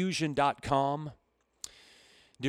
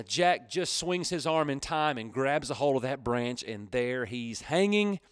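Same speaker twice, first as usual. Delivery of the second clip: ordinary talk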